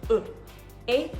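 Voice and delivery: e then a then e, short and distinct; contrasting vowel sounds. Shown as a demonstration. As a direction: contrast a against the schwa e. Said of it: "very clearly different from the schwa sound".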